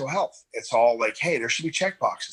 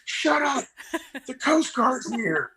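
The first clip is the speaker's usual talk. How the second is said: much higher, slow, laughing brush-off; mock dismissive nurse; 11 semitones higher, much slower than usual. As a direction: wave him off while laughing. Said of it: "And they're like".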